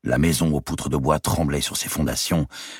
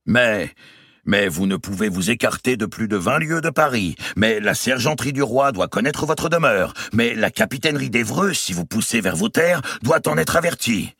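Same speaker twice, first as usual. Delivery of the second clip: a pompous official voice, pitched higher; mocking the captain; 9 semitones higher than usual. Robert mimics the Châtelet captain releasing him. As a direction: parody the jailer's formal announcement. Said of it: "imitant le capitaine du Châtelet".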